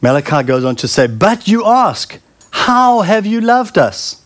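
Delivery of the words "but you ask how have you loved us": slow, raised, emphatic quotation; scripture declaimed. Acted. Malachi quoting the people's question to God, slow high declamation. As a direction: draw the challenge out, pitched high.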